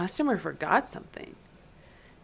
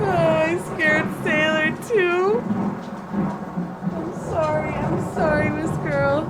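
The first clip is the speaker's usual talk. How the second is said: raised, pleading sing-song apology; high cooing apology; 8 semitones higher, 4 dB louder than usual. Real, not acted. Player apologising to Sailor for the scare, high cooing. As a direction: coo it high, still rattled.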